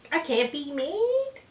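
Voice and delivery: high, slow cat-talk voice; high cooing. Real, not acted. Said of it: "now you wanna sit on my lap".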